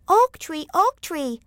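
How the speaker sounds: high calling voice; little boy Yamo. A narrator's reading. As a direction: call up to the tree. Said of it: "said little Yamo".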